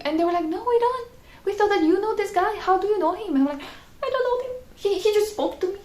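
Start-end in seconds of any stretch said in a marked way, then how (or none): 0.5-3.4 surprised shopkeepers. Retold or imitated. Shopkeepers voiced. 4.0-5.8 high, baffled denial; high protest. Retold or imitated. Vlogger tells shopkeepers she doesn't know the man, high bafflement.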